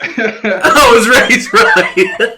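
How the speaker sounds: slow, drawn-out echo, laughing and shouting; mocking laughing echo. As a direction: stretch it out mockingly through laughter. Host mockingly echoes guest's line.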